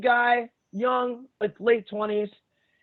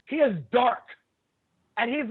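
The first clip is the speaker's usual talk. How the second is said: ordinary talk, nothing marked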